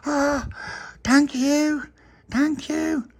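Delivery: very high, tiny grateful creature voice; little puppy. Acted. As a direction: squeak out your thanks like a little pup. Puppy character voice.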